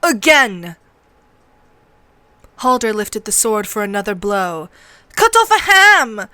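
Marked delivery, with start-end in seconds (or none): 0.0-0.8 loud one-word order; raised drill-master command; curt command. Acted. Drill master orders another blow. 5.1-6.3 high, loud jeering shout; excited, high-pitched yell; gleeful urging. A narrator's reading. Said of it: "Rast urged, laughing".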